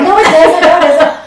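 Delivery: high, loud laughing no it doesn't; laughing protest. Retold or imitated. The girls protesting to Mama, high and laughing. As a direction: laugh while insisting it is not drugs. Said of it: "And we're like".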